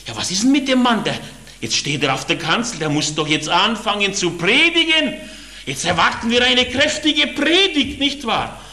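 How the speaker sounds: voicing the restless crowd, pitched high; mock the grumbling congregation as they wonder aloud; high-pitched impatient whispering of the crowd; impatient churchgoers. Congregation grumbling at silent man.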